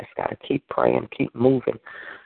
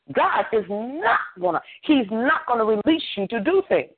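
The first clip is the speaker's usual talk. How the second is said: higher, emphatic preacher's admonition; preaching exhortation; 9 semitones higher than usual. Real, not acted. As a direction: drive each command home to the congregation. Preaches exhortation to stop whining.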